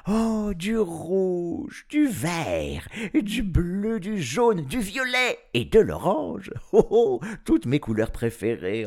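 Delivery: high, excited listing of treasures; enraptured. A narrator's reading. Professor receiving paints from children, thrilled. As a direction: exclaim over each one, pitched high.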